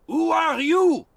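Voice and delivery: high, loud, slow shout; loud high sentry shout. Acted. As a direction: bellow it into the fog. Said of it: "shouted the Frenchman".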